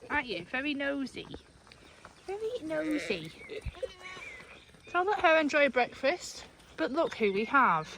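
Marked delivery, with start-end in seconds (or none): 2.3-3.3 higher, slower sing-song coo. Real, not acted. Farmer teasing her nosy lamb, higher slow coo.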